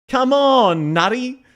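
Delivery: high-pitched copy of "Come on, nutty"; mimicking game character. Streamer mimics the clip just heard, high mock voice. Echoes the played game line.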